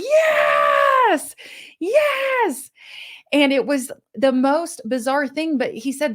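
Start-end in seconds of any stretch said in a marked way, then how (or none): 0.0-2.7 cheering shout. Retold or imitated. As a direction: whoop it up like a cheering crowd. Room cheers a shared failure.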